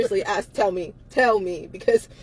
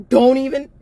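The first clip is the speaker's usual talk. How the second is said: ordinary talk, nothing marked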